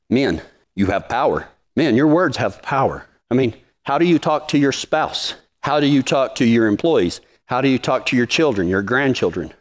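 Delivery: ordinary talk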